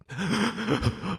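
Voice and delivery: sharp gasp for breath; sobbing gasp. Real, not acted. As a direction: gasp like crying hard. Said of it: "body crying".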